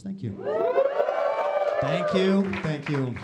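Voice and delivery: a touch of a gracious celebrated artist accepting praise; successful artist. Pretend. Comic playing successful artist, gracious thanks. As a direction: lightly mimic a famous artist bowing to applause. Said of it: "quick impression of a successful artist".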